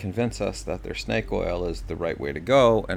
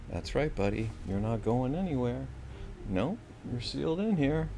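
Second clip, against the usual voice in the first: talking to the dog; 5 semitones higher, 6 dB quieter than usual. Real, not acted. Talking to his trapped dog.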